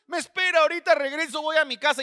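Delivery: high-pitched imitation of weeping churchgoers; mock a tearful appeal, pitched well up; retold tearful plea. Weeping members ask the pastor to wait, imitated tearful plea.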